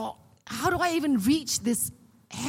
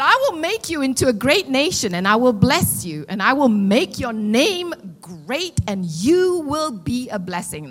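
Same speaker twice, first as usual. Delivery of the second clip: preaching scripture; 8 dB louder than usual. Acted.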